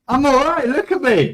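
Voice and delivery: relieved survivor showing he is unhurt; survivor's relief. Retold or imitated. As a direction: proclaim you survived, showing yourself off. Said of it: "lived through something".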